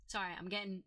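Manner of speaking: ordinary talk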